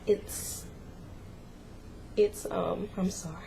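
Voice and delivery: fighting back tears; real crying. Real, not acted. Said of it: "I'm gonna try not to cry".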